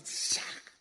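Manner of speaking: unvoiced hissed chop; sharp vocal slash effect; slicing sound. Shown as a demonstration. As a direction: imitate a blade cutting through. Imitates a blade slicing.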